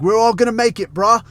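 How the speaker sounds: rally the lads like an Australian fitness influencer; quoting the meme in an Aussie bro voice; Australian gym bro impression. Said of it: "from one of this guy's little videos".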